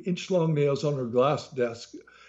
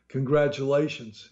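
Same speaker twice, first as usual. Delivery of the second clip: usual speaking voice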